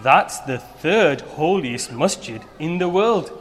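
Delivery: ordinary delivery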